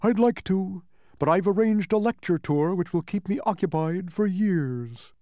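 high-pitched character bug voice; blustery humbug. A narrator's reading. Bug excuses himself to the boy, blustery. Bug declines Milo's plea in story.

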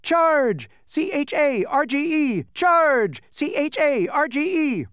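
high, ringing shout, drawn out on CHARGE; shouted battle cry. A narrator's reading. Spelling bee buzzing overhead as the army attacks, shouting. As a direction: shout it like a rallying call.

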